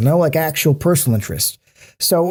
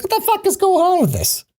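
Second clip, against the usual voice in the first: very high, incredulous squeal of a question; mock exasperation. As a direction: pitch it way up in mock disbelief. His own exasperated reaction, replayed.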